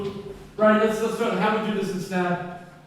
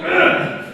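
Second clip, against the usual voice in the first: high, loud fed-up ugh; mock groan; 12 semitones higher, 7 dB louder than usual. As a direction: groan like a student tired of changes. Students groan at square.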